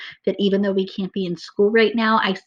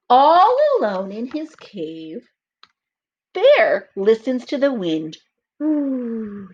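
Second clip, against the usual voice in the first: put-on slow, lively read-aloud for children; storybook reading; 3 semitones higher, 3 dB louder, much slower than usual. A narrator's reading. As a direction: read it like story time for little kids. Reads picture book aloud.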